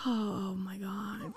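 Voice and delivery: slow embarrassed whisper. Real, not acted. Embarrassed that her co-host named the cop, whispered. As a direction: breathe it out, mortified.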